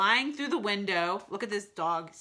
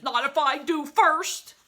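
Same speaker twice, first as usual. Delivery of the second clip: loud character comeback; snappy retort; 7 semitones higher, 9 dB louder than usual. A narrator's reading. Mister Snake answers back in a story, loud retort. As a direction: fire back a retort, louder than the narration.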